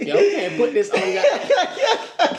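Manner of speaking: laughing through the words; can barely talk for laughing. Real, not acted. Laughing at the story.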